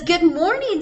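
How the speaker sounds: cheerful morning hello as Mom; Mom's voice. Acted. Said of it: "said Mom".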